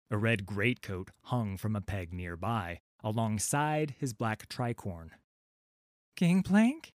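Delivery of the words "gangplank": high, awed gasp of a name; a frightened boy breathes the name; awed fearful breath. Acted. Urchin to captain, breathed in fear and awe. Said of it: "breathed the urchin, his voice thick with fear and awe".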